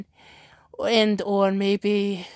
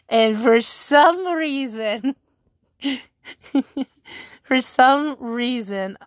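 slow, giggling repeat of the words; can't get the phrase out for laughing; laughing through it; slower than usual. Real, not acted. Streamer cracking up retelling the Cesaro tweet, slow giggling.

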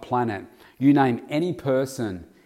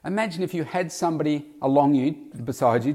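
ordinary talk

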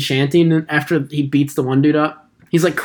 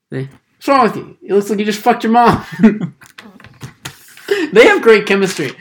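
ordinary talk

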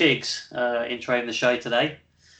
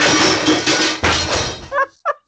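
high breathy laugh; 9 dB louder than usual. Real, not acted. Another take falls apart.

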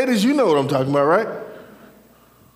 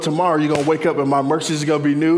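preaching, building; 2 semitones higher, 4 dB louder than usual. Real, not acted. Preacher promises the congregation new mercy each day, building fervently.

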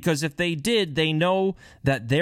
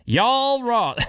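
Yoel Roth, drawn out in a mock country twang; mock country accent; 9 semitones higher, 4 dB louder than usual. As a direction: say Yoel Roth like a country boy, half-joking. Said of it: "I should say that with a country accent".

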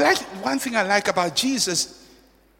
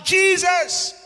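shouting for mercy; 10 semitones higher than usual. Acted. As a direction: shout over the crowd for mercy. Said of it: "but he's shouting".

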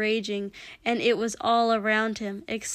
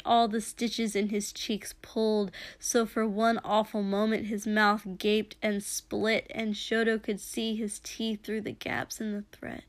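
usual speaking voice

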